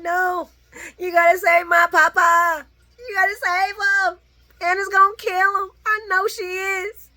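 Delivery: put-on high, loud frantic little girl begging; high pleading child. Vlogger voicing Charlotte begging Laura to save papa, high frantic plea. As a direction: beg like a panicked child for her papa. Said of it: "She's like".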